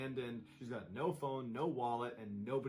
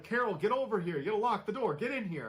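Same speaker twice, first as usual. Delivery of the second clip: frantic, much higher and louder, half screamed; urgent retold panic, pitched way up and loud; panicked yell; 8 semitones higher, 8 dB louder than usual. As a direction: yell in terror that robbers are inside. Panicked call about robbers.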